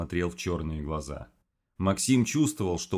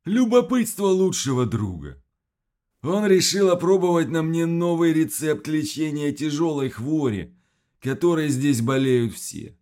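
higher, louder creature telling; monster voice; 9 semitones higher, 6 dB louder than usual. Acted. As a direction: speak like the monster.

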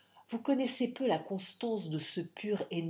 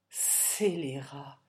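cri indigné; 5 semitones lower, much slower than usual. A narrator's reading. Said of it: "s'écria milady Lindon".

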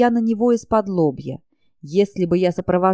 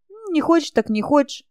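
unbothered shrug; 6 semitones higher than usual. A narrator's reading. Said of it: "нисколько не расстроилась я".

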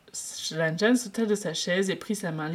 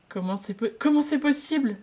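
welling tears; 8 semitones higher, 4 dB louder than usual. Acted. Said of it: "les larmes lui monter aux yeux".

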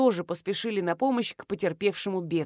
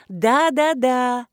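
loud, higher-pitched eager yeses; emphatic confirmation; 3 semitones higher, 6 dB louder, much slower than usual. A narrator's reading. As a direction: confirm it gleefully. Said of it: "подтвердила наша кулинарка". (радостно подтверждая)

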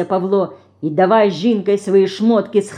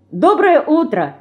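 higher-pitched cheery greeting; cheerful reply; 8 semitones higher than usual. A narrator's reading. Динка answers Лёня's morning greeting, cheerfully. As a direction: sing out a merry hello. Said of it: "весело откликнулась Динка".

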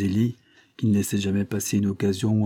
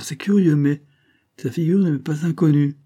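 higher, louder note of puzzled recognition; intrigued, raised-pitch surprise; surprised; 7 semitones higher, 5 dB louder than usual. A narrator's reading. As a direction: react to a face you half know. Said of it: "s'arrêtèrent surpris".

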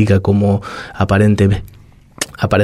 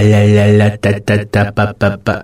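put-on baby babbling. Shown as a demonstration. Radio guest demonstrating how toddlers babble, playful babble. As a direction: babble la la ta ta pa pa like an infant. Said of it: "niños pequeños cuando empiezan a".

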